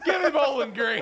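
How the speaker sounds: high, loud call through laughter; gleeful shout. Real, not acted. Host flipping his pick for the joke, gleeful shout. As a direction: belt out the switched pick, giddy.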